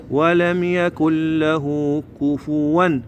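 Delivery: slow recitation with the vowel of له drawn out; madd on لَهُ. Shown as a demonstration. Teacher shows the small waw, long drawn vowel. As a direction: stretch له to "lahuu", then كفوا. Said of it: "هاي المد له".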